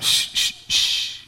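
soft, long shush; mock-tender shh. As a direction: hush Dad tenderly, in mockery. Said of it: "do they go".

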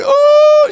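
very high, loud imitated wail; hammed-up motherly "oh" of distress; mock tearful wail. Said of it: "she'd be crying".

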